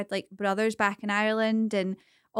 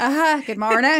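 higher, louder put-on greeting; mock morning greeting; 4 semitones higher, 7 dB louder than usual. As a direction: play along with a loud, cheery fake voice. Joining the fake-accent waking joke.

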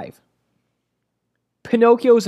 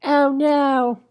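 very high, drawn-out character voice; put-on high voice, words stretched out; Bruce from Family Guy; 11 semitones higher than usual. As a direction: do a cartoon impression, pitched way up. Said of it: "talk like Bruce from Family Guy".

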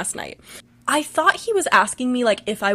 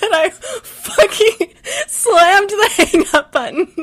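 slow, high, breaking into laughter; laughing; 9 semitones higher, 6 dB louder, slower than usual. Real, not acted. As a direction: push the words out through laughter. Laughing while retelling the scare.

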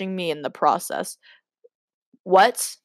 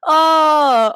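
startled oh; 8 semitones higher, 7 dB louder than usual. Real, not acted. Reacting to the story.

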